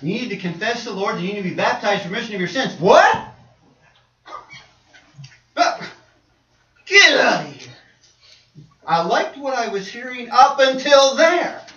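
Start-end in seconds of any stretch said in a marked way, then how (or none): 2.8-3.3 sudden high, loud cry of disbelief; shocked outburst. Acted. Listener balking at the invitation to be baptized, shocked. 5.6-7.7 loud acted brush-off; indignant dismissal. Listener rejecting the call to be baptized, indignant.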